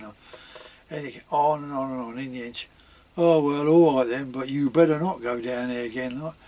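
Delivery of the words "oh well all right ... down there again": loud, exasperated retold mum; exasperated giving in. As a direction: give in loudly, with a firm warning. Mum gives in with a warning.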